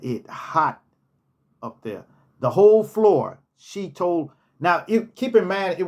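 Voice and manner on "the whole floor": "The whole floor" louder with "whole" stressed; emphatic whole floor. Shown as a demonstration. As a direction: punch "whole" in disbelief. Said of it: "The whole ninth floor".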